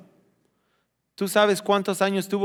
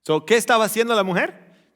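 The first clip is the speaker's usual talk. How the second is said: ordinary talk, nothing marked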